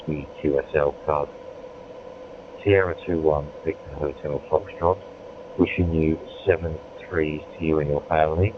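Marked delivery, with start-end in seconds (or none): none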